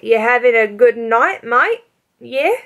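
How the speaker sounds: bad Australian with rising questions; put-on Australian accent. Playing along with the stranger, put-on Australian accent. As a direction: fake an Australian accent, matey. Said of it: "starts talking in an Australian accent".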